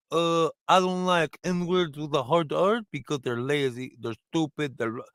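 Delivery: put-on slow, deliberate Russian accent. Tough Russian guard answers a poker player, blunt Russian accent. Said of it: "the only way I can do a Russian accent".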